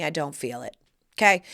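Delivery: normal talking voice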